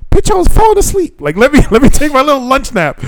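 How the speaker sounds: very high, indignant retold complaint; exasperated rant. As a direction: squeal it out in mock outrage. Retelling anger at teacher.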